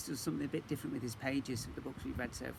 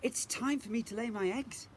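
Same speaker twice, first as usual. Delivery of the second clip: Mrs Seahorse voice; 9 semitones higher than usual. A narrator's reading.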